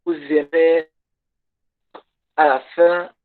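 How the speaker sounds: ordinary talk, nothing marked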